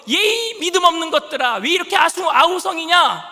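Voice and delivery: Jesus scolding his disciples angrily; angry rebuke. Acted. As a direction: rebuke the faithless with heat. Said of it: "예수님도 화가 나셔가지고".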